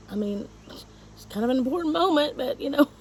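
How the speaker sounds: whispered wry aside, breath only. Real, not acted. To the audience about a key moment, whispered and half crying. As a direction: drop to a whisper with a catch in the throat.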